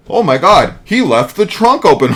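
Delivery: high, loud hammed-up shock; mock announcing out loud. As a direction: overact a horrified discovery for all to hear. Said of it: "Why would you announce that out loud?".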